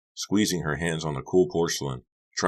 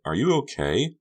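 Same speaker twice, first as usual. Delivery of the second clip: Honey worried; 10 semitones higher than usual. Acted. Honey concerned.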